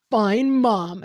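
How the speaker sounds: loud, sulky "Fine, Mom"; sulky tween. Retold or imitated. Tween son answering mom's warning, loud and sulky. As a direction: snap it back like a fed-up tween.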